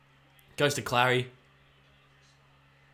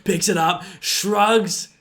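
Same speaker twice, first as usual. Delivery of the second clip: commentator mode; 3 semitones higher, 6 dB louder than usual. Pretend. Fan switches into commentator mode for the play, calling it loud. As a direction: call it like a TV footy commentator. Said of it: "Let's go commentator mode".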